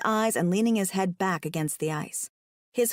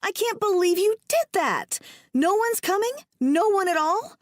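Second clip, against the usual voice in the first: high, louder, incredulous outburst; yelling; 11 semitones higher, 4 dB louder than usual. A narrator's reading. Woman learning nobody will rescue them, yelling. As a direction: yell in rising disbelief. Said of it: "I'm yelling at a man".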